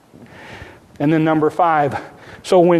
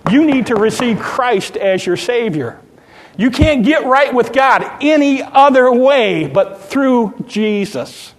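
loud, high urgent plea; God knocking; 7 semitones higher, 5 dB louder than usual. Retold or imitated. God calling sinners through life's troubles, loud urgent plea. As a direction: press the call with rising force. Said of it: "him knocking on the door".

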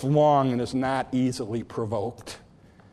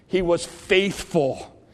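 fervent preaching; 8 semitones higher, 6 dB louder than usual. Acted. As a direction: preach it hard to the congregation. Preaching with full force.

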